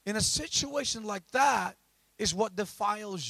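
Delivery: loud, high-pitched pulpit exhortation; full-force preaching. Acted. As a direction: drive each phrase home to the congregation.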